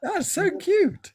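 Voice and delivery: high, slow cooing. Real, not acted. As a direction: coo over something adorable. Reacting to sweet message.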